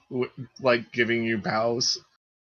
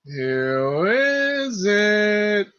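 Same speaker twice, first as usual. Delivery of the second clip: voice from inside; 10 semitones higher, 5 dB louder, much slower than usual. Acted. Voice calling from inside the room.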